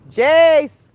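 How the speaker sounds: loud high call across the yard. Real, not acted. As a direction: shout his name to fetch him. Calling son repeatedly.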